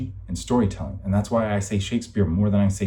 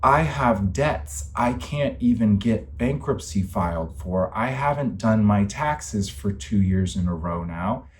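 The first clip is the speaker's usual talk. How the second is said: ordinary delivery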